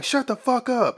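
a cop with his gun drawn silencing a suspect; barking cop. Retold or imitated. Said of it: "He like".

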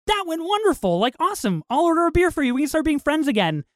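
high-pitched, hopeful chirp; retold cheery optimism. Retelling his visit to Colm's house, mock-cheerful. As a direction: imitate a friend eager to make up, voice lifted high.

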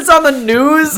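high-pitched, laughing quote; high movie quote voice. Pretend. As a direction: laugh through a squeaky quote.

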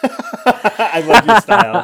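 slow, loud and high through laughter; barely holding it together; real amused laughing praise. Real, not acted. Cracking up at friend's plan.

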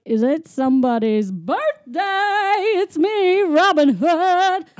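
high, loud, drawn-out announcement; hammed-up triumphant reveal. Imitating Robin revealing himself to the birthday kid, triumphant. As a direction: build to a big ta-da on the name.

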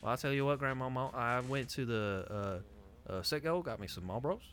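quiet, hesitant Southern accent; attempted Southern accent. Shown as a demonstration. Imitating family on request, in a quiet Southern accent. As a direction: try a genuine Southern accent, softly. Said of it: "Hit me with a legit trying Southern accent".

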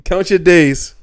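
loud mock warning; loud threat. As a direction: bark it out as a threat.

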